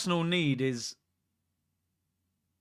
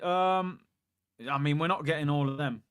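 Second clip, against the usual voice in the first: normal talking voice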